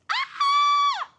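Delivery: very high, loud shriek of excitement; piercing squeal of joy; excited scream. Real, not acted. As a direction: scream with sheer delight. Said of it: "I'm screaming".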